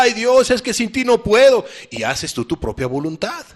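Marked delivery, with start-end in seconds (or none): 0.0-1.6 higher, louder whiny plea to God; mock weepy prayer. Someone crying to God yet disobeying, mock weepy plea. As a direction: ham up a tearful prayer. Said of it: "Y otra vez vuelve a llorar".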